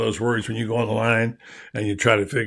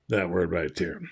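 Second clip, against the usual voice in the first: whisper; 7 semitones lower, 11 dB quieter than usual. Real, not acted. Holds up the loyalty word.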